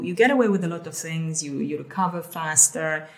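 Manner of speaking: ordinary talk, nothing marked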